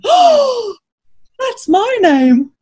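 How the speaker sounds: high gasp, then slow delighted realisation; amazed rat. Acted. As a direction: gasp and savour it as a little rat. Rat thrilled to hear his name.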